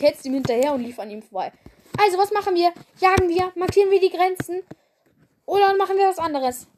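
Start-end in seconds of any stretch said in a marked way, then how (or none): 2.0-6.7 high, loud, eager questions tumbling out; eager apprentice. Acted. The apprentice pestering the leader about their patrol. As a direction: bounce with eagerness like a kid.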